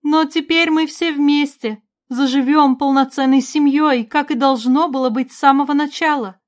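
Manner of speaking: high, loud, hopeful declaration; proclaiming. A narrator's reading. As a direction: announce it as a mother rallying her family. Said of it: "провозгласила Ванора".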